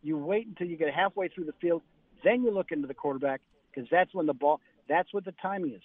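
ordinary talk

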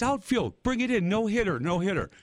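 high-pitched mock field call; imitated catcher's call. Catcher waves in the outfield for the old guy, imitated call.

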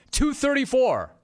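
high, excited announcement; excited reveal. Real, not acted. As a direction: call out the figure high and excited. Finds the number on chart.

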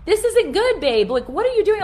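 high, loud panicked pleading; frantic "what are you doing" to husband; alarmed protest. Retold or imitated. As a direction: squeal it at your husband in alarm. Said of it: "I'm like".

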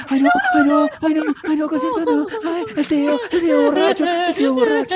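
put-on very high, panicky child voice; shrill little girl in a panic; traumatized niece. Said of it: "va a estar así traumatizada".

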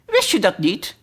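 lightly voiced high, shrill little flower; a hint of small piping voice; another daisy calling. A narrator's reading. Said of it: "riep een andere Margriet".

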